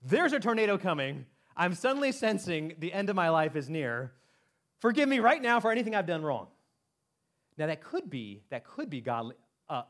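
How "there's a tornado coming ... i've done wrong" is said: mock panic. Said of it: "Or it could be just".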